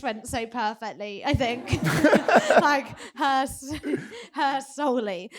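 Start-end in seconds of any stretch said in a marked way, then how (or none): 1.2-5.3 louder, higher laughing talk. Real, not acted. Joking her mum claims credit, laughing. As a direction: giggle through the words.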